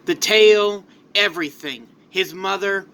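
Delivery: plain reading voice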